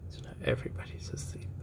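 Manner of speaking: quick, very soft bedtime whisper; hushed whisper. Acted. Reader telling a child everybody's asleep, whispered. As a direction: whisper so as not to wake anyone.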